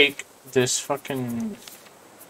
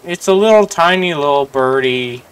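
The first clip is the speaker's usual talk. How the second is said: coaxing a wild bird; 4 semitones higher, 8 dB louder than usual. Real, not acted. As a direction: coo at the tiny bird.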